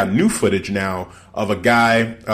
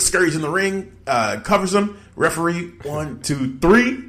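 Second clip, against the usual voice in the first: rising excitement; 7 semitones higher than usual. Real, not acted. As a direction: pitch climbing into an excited count. Building excitement of the pin.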